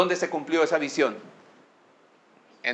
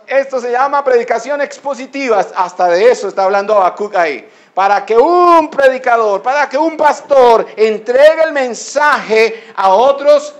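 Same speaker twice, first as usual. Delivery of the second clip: loud, high-pitched sermon delivery; forceful preacher, punching key words; impassioned preaching; 7 semitones higher, 12 dB louder than usual. Acted. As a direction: drive each phrase out to the congregation.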